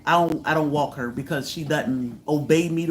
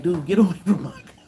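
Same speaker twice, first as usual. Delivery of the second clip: quick, laughing shoo-away; laughing dismissal; faster than usual. Retold or imitated. Telling a stranger at the car to leave, laughing.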